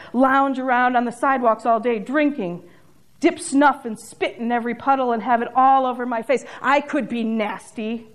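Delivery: normal talking voice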